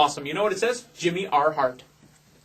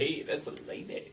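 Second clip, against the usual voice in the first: breathy unvoiced aside; whispering; 11 dB quieter than usual. Mock scolding aside.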